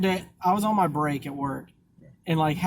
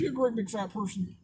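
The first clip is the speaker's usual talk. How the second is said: high-pitched, softer movie-killer impression; Buffalo Bill; 6 semitones higher, 5 dB quieter than usual. Friend quoting the film killer mid-chat, high put-on voice. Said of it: "Buffalo Bill has a great".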